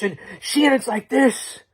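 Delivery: ordinary talk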